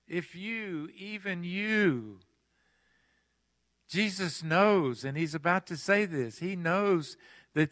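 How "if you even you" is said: softer, slow pleading plea. Shown as a demonstration. Preacher voicing Jesus pleading over the city, soft and slow. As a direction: beg gently, as if to a loved one. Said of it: "Doesn't that sound like a pleading statement".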